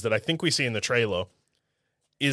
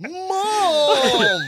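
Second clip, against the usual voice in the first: whiny son voice; 13 semitones higher than usual. Pretend. Voicing Ray to his mother.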